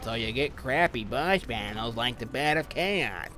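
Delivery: high, slow, nerdy mock voice; mocking other YouTubers. Mocking other Dark Souls commentators, high nerdy whine. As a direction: parody a goody-goody gamer whining. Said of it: "all they're talking about is".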